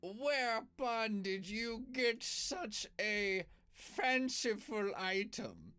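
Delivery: high, slow pompous kobold. Acted. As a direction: put on airs with big words. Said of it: "he says in Draconic".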